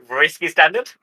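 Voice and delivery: usual speaking voice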